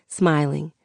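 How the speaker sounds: usual speaking voice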